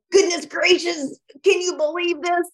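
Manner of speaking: mock-horrified voice message to a friend; exasperated rant. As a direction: ham up the outraged gossip. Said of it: "I'd probably Voxer you and be like".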